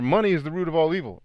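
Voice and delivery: quick, lightly mocking recital; mock quote. Mocking a common misquoted saying, quick and light. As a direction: rattle off the cliché dismissively. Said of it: "bastardized versions of scripture".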